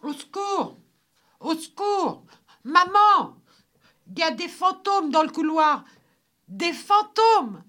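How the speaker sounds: put-on high, loud panicked child's cry; shrieked alarm, high-pitched; screaming for help. A narrator's reading. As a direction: yell in terror for your mother. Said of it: "pour se mettre à crier".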